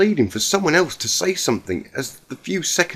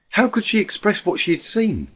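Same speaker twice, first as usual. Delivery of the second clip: plain reading voice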